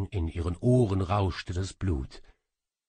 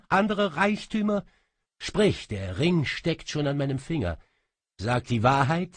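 much higher, louder, building interrogation; king leans in, voice rising and louder; growing louder; 7 semitones higher, 5 dB louder than usual. A narrator's reading. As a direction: build up the pressure word by word. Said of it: "sprach immer lauter".

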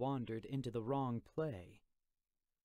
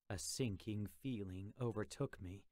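ordinary delivery

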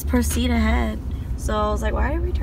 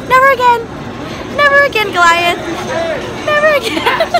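loud, high cries of never again; emphatic; 12 semitones higher, 5 dB louder than usual. Real, not acted. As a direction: swear it off, loudly. Just got off roller coaster.

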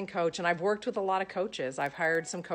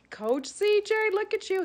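gushing; 4 dB louder than usual. Acted. Gushing at the coach.

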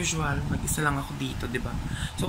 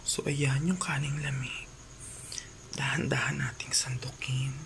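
whispering; 3 semitones lower, 6 dB quieter than usual. Real, not acted. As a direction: whisper so the sleeper stays asleep. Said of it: "medyo pa bebe yung boses natin".

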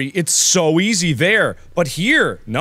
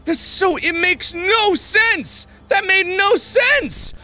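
real exasperated rage; furious shout; 12 semitones higher than usual. Real, not acted. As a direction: shriek it at the unfair game. Raging at the level.

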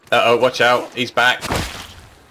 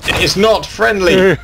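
alarmed shout; 9 semitones higher, 5 dB louder, slower than usual. Real, not acted. Spider spitting at the players.

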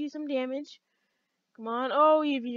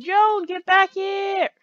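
loud, higher, drawn-out call; 6 semitones higher, 7 dB louder, much slower than usual. Real, not acted. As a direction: call after someone getting away. Calling back the drone.

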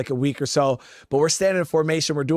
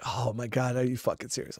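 mortified mutter; 5 semitones lower, 9 dB quieter than usual. Retold or imitated. Mortified inner reaction in formation.

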